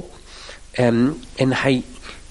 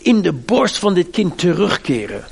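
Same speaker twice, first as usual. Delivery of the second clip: hoog en luid smekend gebed; fervent prayer; 5 semitones higher, 3 dB louder than usual. Retold or imitated. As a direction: roep het gebed vurig uit, hoger en luider. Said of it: "En Elia bad". (Elia bidt vurig en luid om het leven van het kind)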